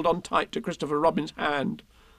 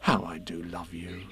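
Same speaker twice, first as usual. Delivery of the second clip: low, soft declaration of love; tender; 11 semitones lower, 8 dB quieter than usual. A narrator's reading. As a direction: murmur it fondly. Tender closing words to Pooh.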